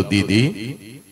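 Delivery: a hint of a small brother's sad question; little brother asking. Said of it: "भाऊ तुला म्हणतो". (भावाचा हळवा प्रश्न)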